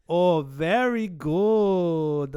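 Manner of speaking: slow, drawn-out Italian great-aunt; mock Italian accent. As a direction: put on a thick Italian accent, very slowly. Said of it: "She would be like".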